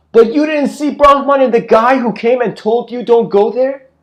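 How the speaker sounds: very high and loud pointed question; loud climax question. Retold or imitated. Guru confronts the disciple with his blind spot, loud. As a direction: slam home the punchline.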